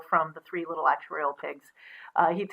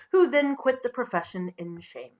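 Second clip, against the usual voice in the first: plain reading voice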